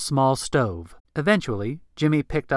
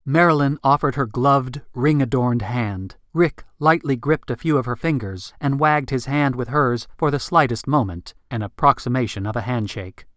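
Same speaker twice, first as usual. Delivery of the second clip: ordinary delivery, nothing marked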